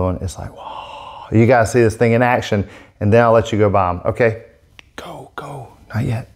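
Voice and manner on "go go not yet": hushed whisper; playful hushed aside. Acted. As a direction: whisper it like a secret. Whispered to viewers.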